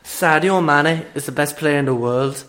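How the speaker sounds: lightly put-on Liverpool accent; a hint of a Scouse football fan; Scouser impression. Mate doing a light Scouse accent for the podcast. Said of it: "Okay, Scouser impression".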